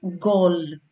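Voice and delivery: γκολ drawn out with a hard initial g; pronunciation example. Shown as a demonstration. Teacher giving an example word, slow demonstration of g in γκολ. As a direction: slowly model the hard g opening γκολ. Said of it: "όμως προφέρεται ως Γ".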